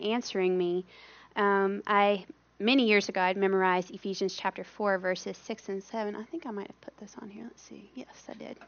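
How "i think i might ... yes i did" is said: very soft, half-whispered to herself; muttered aside. Real, not acted. Speaker checks her own notes, whispered aside to herself. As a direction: drop to a whisper while checking.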